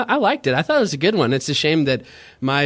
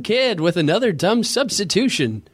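negative catchphrase; 6 semitones higher than usual. Pretend. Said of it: "I'll be the first announcer to ever have a negative catchphrase".

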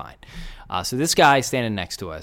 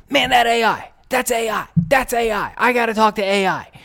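high, excited put-on drunk fan voice; 11 semitones higher, 3 dB louder than usual. Drunk Kevin Hart lookalike begging to talk to Allen Iverson, high excited mimicry. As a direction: squeal it like a starstruck drunk. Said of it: "And he's like".

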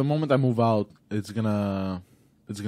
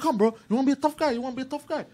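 taunting; 11 semitones higher, faster than usual. Retold or imitated. Daring the aggressor to fight.

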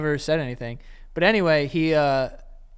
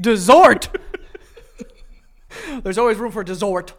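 laughing correction; 5 semitones higher, 8 dB louder than usual. Real, not acted. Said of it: "That's not what they say".

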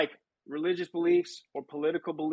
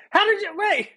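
laughing disbelief; 9 semitones higher, 3 dB louder than usual. Real, not acted. Co-host reacts in disbelief.

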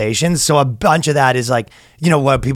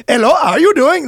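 mock Indian accent; 12 semitones higher than usual. Mocking a friend back from India, Indian accent. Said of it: "you're out there being like".

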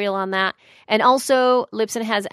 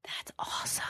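whispered aside; 16 dB quieter than usual. Real, not acted. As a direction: whisper it under your breath. Whispered reaction.

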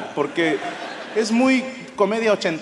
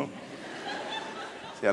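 ordinary talk

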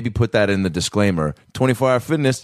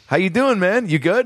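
higher-pitched guy checking in; mock bro greeting; 9 semitones higher than usual. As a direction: imitate an awkwardly friendly gym bro. Acted exchange between two gym guys.

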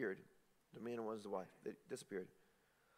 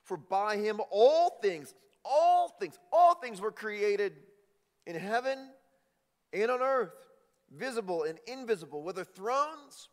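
high, loud, building proclamation; preaching at full force; 6 semitones higher, 8 dB louder than usual. Acted. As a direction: proclaim the scripture with rising fervor. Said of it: "He says this".

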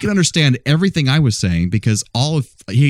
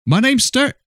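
much higher Scottish voice, Stuart as Start; Scottish accent. Retold or imitated. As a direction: mimic the Scot's name. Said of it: "I said".

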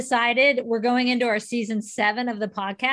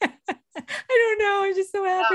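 laughing; 7 semitones higher than usual. Pretend. Co-host echoes, laughing.

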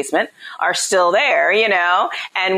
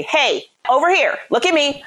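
attention-catching call, pitched up, without yelling; raised voice; 9 semitones higher than usual. Shown as a demonstration. Mom catching her children's attention, demonstrated raised voice. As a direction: call the kids to attention, higher but controlled. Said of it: "I raise my voice".

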